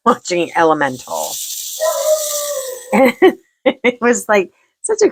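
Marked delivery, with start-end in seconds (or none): 1.4-3.3 high giggle. Real, not acted. Laughing at own crying story.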